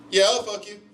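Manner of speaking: high-pitched eager call; mock yelling back. Runner answering the owl's offer, high mock yell. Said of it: "I just keep yelling".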